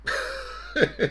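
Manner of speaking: a burst of real high laughter at his own joke; short high laugh. Real, not acted.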